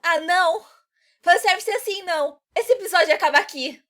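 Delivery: very high, loud shriek; high-pitched, shouted objection; outraged protest. Acted. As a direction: shriek in protest to shut it all down. Acted outraged refusal ends episode.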